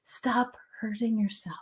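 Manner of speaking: choked-up, whispered plea; real emotion, barely voiced; emotional whisper. Real, not acted. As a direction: whisper it, moved by the words. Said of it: "I'm getting emotional on this".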